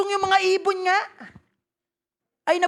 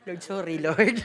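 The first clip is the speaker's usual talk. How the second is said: sheepish laughing sorry, much softer; laughing apology; 9 semitones lower, 6 dB quieter than usual. Real, not acted. Preacher laughing as he confesses to God. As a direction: say sorry to the Lord through a laugh.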